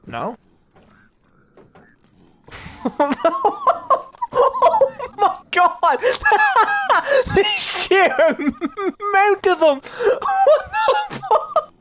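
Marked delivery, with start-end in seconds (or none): 2.8-11.7 high squealing laughter through the words; helpless real laughter at the swarm; laughing hard. Real, not acted. Overwhelmed by swarm of mobs.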